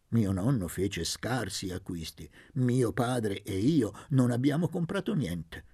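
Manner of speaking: ordinary delivery, nothing marked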